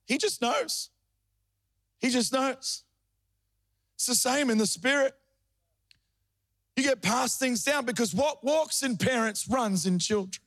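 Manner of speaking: high-pitched, surging Pentecostal preaching. Acted. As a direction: preach it up and ride the cadence. Preaching at full force.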